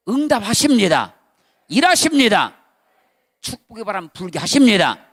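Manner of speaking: loud preacher's declaration; full-force preaching. Acted. Pastor to congregation, loud preaching. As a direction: proclaim it to the congregation.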